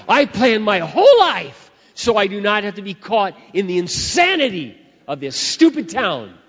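loud, high-pitched venting; exasperated rant. Real, not acted. Preacher venting to congregation about rush hour, exasperated. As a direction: punch it out in mounting exasperation.

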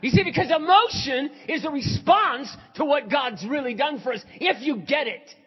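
loud, high, shouted sermon; full-force preaching. Real, not acted. Pastor explaining emotion as a response to grace, preaching forcefully. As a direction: proclaim it to the whole room.

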